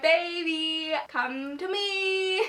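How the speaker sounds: slow, drawn-out pleading coo; mock cooing. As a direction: coo it as if calling someone in for a hug. Imagined hugging a beloved character.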